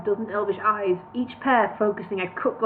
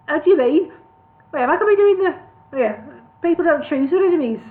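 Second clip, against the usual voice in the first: higher Birmingham voice for Bingo; Birmingham accent; 7 semitones higher, 6 dB louder, faster than usual. Acted. Bingo questioning elf lord in Birmingham accent. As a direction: Brummie voice, louder. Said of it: "the wrong accent".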